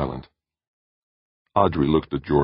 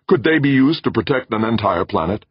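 urgent, louder call; fairly shouted; 8 semitones higher, 6 dB louder than usual. Acted. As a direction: burst out with the idea. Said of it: "Jordan fairly shouted".

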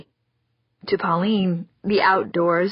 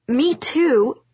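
put-on high, louder girl's retort; indignant girl; 6 semitones higher, 4 dB louder than usual. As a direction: snap it back like a hurt kid. Daughter protests.

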